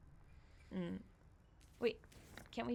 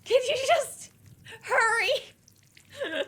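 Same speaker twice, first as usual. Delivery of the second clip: much higher, loud pleading; desperate plea; 11 dB louder, much slower than usual. Real, not acted. Begging her partner to hurry through the bug room, shrill panic. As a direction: beg them to hurry up, frantic.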